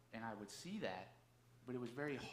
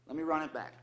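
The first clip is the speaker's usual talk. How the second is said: excited retold call; 6 semitones higher, 13 dB louder than usual.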